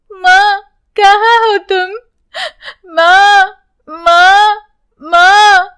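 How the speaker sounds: put-on high, loud calls of a searching child; tearful child calling for her mother. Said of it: "यूं बुलाती है".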